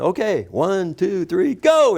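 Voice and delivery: counting down, pitch climbing to a yell; building excitement. Retold or imitated. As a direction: psych yourself up and leap. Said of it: "And I went".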